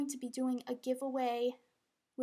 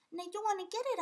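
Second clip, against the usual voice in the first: pet talk; 8 semitones higher, much faster than usual. Real, not acted. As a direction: chatter sweetly at the dog. Talking to the dog.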